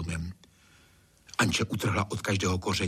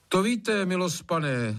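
cutting in; 9 semitones higher, slower than usual. A narrator's reading. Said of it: "zkouší zastavit Trautenbergovu brečavou notu".

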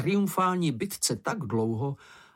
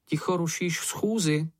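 mild, higher-pitched reprimand; napomenutí; 5 semitones higher than usual. A narrator's reading. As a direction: scold lightly, voice raised in pitch. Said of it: "napomenul jí Ferko". (mírně káravě, vyšším hlasem)

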